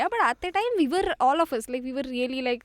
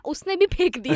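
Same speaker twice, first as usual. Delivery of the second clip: ordinary talk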